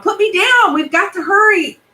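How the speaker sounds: put-on high, loud boy's yell. Benji yelling at mom to put him down, high and loud. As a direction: shout it out, urgent. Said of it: "But then I yelled".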